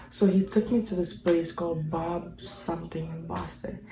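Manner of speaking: a soft whisper, like a secret. Real, not acted. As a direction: breathe it quietly. Whispered aside.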